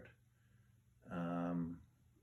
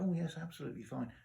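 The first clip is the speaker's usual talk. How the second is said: apologetic reply; 9 semitones higher, faster than usual. Retold or imitated. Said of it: "they were apologetic".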